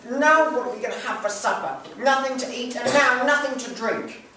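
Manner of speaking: high, loud lament of a mother; despairing mother. Acted. Mother lamenting to the children there is nothing for supper, despairing. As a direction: wail about the empty table.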